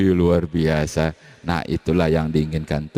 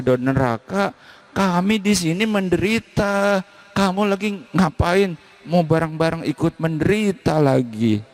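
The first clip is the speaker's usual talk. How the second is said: much higher, harsh scolding from beyond; angry ancestors; 10 semitones higher than usual. Pretend. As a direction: rage down at the foolish descendant. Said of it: "Leluhurmu marah-marah". (leluhur memarahi, suara jauh lebih tinggi)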